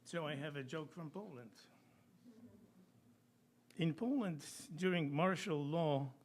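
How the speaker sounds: ordinary talk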